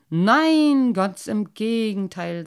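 delighted to accept; happy. A narrator's reading. Grateful father accepts the carp's offer, loud and happy. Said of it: "sagte der Wassermannvater glücklich".

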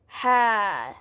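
ordinary talk, nothing marked

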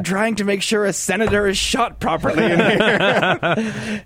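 high, slow, distracted reply with a laugh; mock absorbed brush-off. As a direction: play the engrossed gamer waving her off, slowly. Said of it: "you might be like".

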